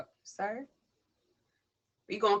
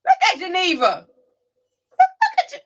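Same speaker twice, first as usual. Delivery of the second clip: high, loud, delighted squeal; excited greeting; 12 semitones higher, 13 dB louder than usual. Real, not acted. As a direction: greet her with a big joyful squeal. Excited greeting arriving friend.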